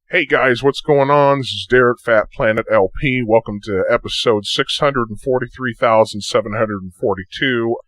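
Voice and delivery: pitch-shifted vlog intro. Shown as a demonstration. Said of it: "say I wanna go a little bit lower".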